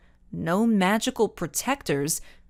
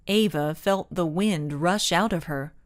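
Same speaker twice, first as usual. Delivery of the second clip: ordinary delivery, nothing marked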